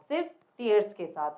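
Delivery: ordinary talk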